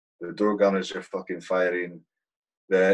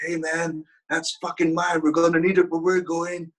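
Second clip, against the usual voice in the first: higher, brash put-on voice of the gunner; tail gunner voice; 9 semitones higher than usual. Gunner grabbing the ammo link, in a high brash voice. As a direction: snap it like a cocky door gunner. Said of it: "He's like".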